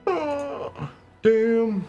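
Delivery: high surprised exclamation. Real, not acted. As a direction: sound impressed and caught off guard. Reacts to a stat.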